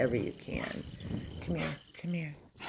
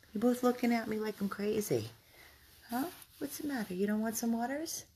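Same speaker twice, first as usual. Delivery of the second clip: high sing-song voice for the dogs; pet talk; 7 semitones higher than usual. Real, not acted. Owner teasing her two dogs who refuse water, high pet talk. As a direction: coo at the dogs playfully.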